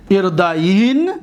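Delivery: ارداین ending on n with no vowel; still final nun. Shown as a demonstration. Teacher showing students the light nun, ارداین ending in vowelless n. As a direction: draw out ارداین and stop on the n. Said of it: "این را ساکن کنید".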